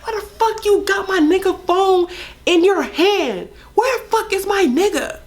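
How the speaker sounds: high, furious mocking of a girl cursing on the phone; snapping girl. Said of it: "the girl snapping on me".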